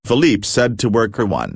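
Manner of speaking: ordinary delivery, nothing marked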